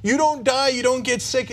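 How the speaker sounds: high, impassioned plea; pleading with fire; passionate. Real, not acted.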